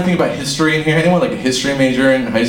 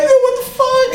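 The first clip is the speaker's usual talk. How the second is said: high-pitched comic protest; mock outraged disbelief; slower than usual. Son kicked by his dad, mock outraged disbelief. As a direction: squeal it in exaggerated indignation.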